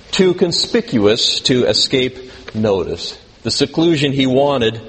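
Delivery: ordinary talk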